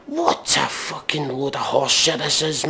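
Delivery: ordinary talk, nothing marked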